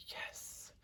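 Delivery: hushed, whispered little victory yes; whispered cheer. Retold or imitated. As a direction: whisper it like a private celebration. Retold private glee at pull.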